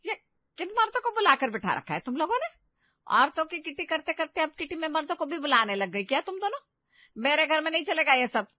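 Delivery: mother-in-law shocked to find men seated at home; shocked anger. Acted. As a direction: demand an answer, scandalised.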